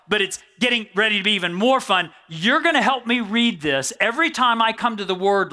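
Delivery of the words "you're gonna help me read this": normal talking voice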